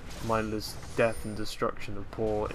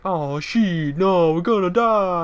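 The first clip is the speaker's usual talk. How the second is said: mock panic; 7 semitones higher, 10 dB louder, slower than usual. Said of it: "They're like".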